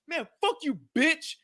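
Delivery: very high mock outburst; retold road rage. Driver cursing out honking woman, high imitated anger.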